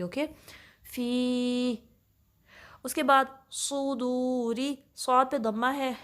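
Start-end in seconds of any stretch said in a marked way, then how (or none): 0.9-1.8 फ़ी with the ee held two counts; two-count madd. Shown as a demonstration. Teacher to students, demonstrating two-count madd. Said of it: "इसकी टू counts होंगे". 3.5-4.8 slow सुदूरी with a heavy saad; slow recitation demo. Shown as a demonstration. Teacher to students, demonstrating heavy saad. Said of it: "सौद को थोड़ा heavy पढ़ना है".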